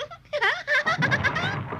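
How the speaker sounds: laughing at one's own joke; teasing laugh. Acted. Bunny joking about the giant girl, teasing laugh. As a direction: let out a long teasing laugh.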